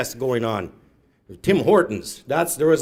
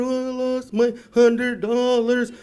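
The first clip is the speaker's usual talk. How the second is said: put-on high, loud, slow child's wail; hammed-up child sob; wailing kid louder; 10 semitones higher, 6 dB louder, much slower than usual. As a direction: let the tantrum swell. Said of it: "kept getting louder and louder".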